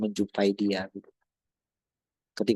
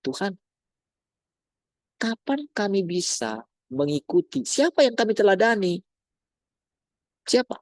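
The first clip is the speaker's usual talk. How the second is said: pleading questions; 9 semitones higher than usual. Acted. Acted pleading questions to God.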